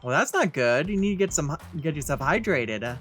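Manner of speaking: ordinary talk, nothing marked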